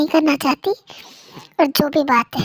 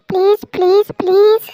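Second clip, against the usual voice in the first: louder, higher desperate pleading; begging please; 4 semitones higher, 6 dB louder than usual. Acted. Husband begging wife to forgive him, loud pleading. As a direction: plead harder with each please.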